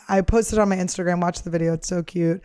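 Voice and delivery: ordinary talk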